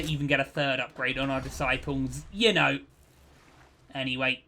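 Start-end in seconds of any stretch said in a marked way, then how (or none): none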